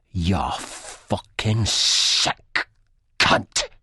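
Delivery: voiced Begbie hissing at the guy who hit him; spat insult. A narrator's reading. Begbie spitting the words at Spud after the headbutt, venomous. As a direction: spit the insult out with contempt. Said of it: "Begbie spits".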